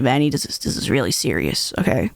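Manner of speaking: very low, mock-solemn father; grave dad. Dad with hands on Vanny's shoulder, deep and grave. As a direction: drop deep for a dad's grave warning. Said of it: "puts his hands on my shoulder and he's like".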